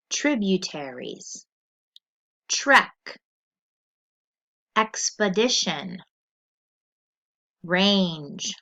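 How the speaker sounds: put-on each word said slowly and clearly for kids to repeat; vocabulary drill read-aloud. Shown as a demonstration. Teacher reading a word list to the class, clear and deliberate. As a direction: model every word for the class to echo.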